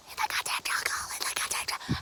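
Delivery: hushed, voiceless aside; incredulous whisper. Real, not acted. Storyteller aghast at friends staying in the forest, whispering. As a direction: whisper it in disbelief.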